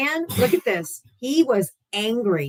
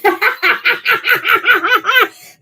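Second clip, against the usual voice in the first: loud high laugh; 13 semitones higher, 10 dB louder than usual. Real, not acted. Streamer reading a chat comment, laughing loudly. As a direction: burst of high-pitched laughter.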